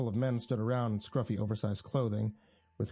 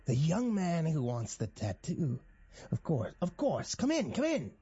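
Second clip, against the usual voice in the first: high swooping voice, drawn out then eager; theatrical host, pitch leaping on each invitation; gleeful, eccentric welcome; 5 semitones higher than usual. A narrator's reading. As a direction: usher him in with high, affected delight. Jack gleefully welcomes the visitor.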